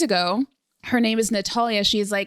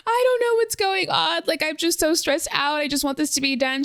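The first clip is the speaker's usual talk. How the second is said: high, strained retold distress; imitating crying on the phone; 6 semitones higher than usual. Telling her astrologer friend on the phone, imitating her own crying. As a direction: replay the tearful phone call, half-joking. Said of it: "I'm calling her on the phone crying".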